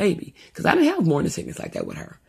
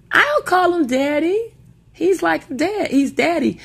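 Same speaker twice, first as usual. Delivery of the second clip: put-on little girl voice; 12 semitones higher, 4 dB louder, slower than usual. Young daughter quoted.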